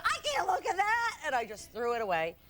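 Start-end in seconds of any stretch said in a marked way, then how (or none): 0.0-1.2 very high, flustered retold cry; overwhelmed squeal. Girlfriend retelling seeing the ring box, overwhelmed squeal. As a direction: squeal and refuse to look.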